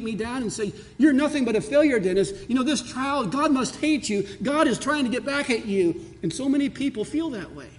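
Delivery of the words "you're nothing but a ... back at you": higher, louder accusing voice; condemning inner voice. Retold or imitated. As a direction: accuse him like a cruel inner critic. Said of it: "inner voices that continually condemn me".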